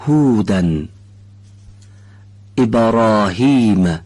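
هذا، اهدنا، الهدى said slowly with a clear breathy h; slow model reading of each word, leaning on the h; Arabic h words demo. Shown as a demonstration.

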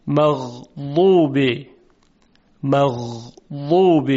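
slow مغضوب with smooth, soft غ; soft Arabic ghayn. Shown as a demonstration. Teacher demonstrating the correct smooth ghayn. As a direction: glide the غ of مغضوب softly, no stop. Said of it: "صدای حرف غین نرم و روان هست".

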